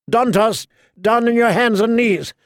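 put-on high, loud cruel boy king; commanding king. A narrator's reading. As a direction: bark the order like a spoiled king. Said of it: "the king commanded".

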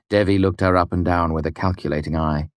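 usual speaking voice

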